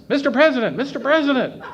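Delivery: calling out loud and high across the lobby; high, loud call for attention; yelling. Retold or imitated. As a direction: shout after the president to stop him. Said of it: "and he yells".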